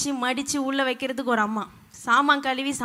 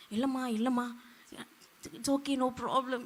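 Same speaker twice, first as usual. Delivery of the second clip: much softer, slow coaxing murmur; mock soothing; 11 dB quieter, slower than usual. Preacher imitating people soothing others, soft and slow. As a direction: pat them gently with the words.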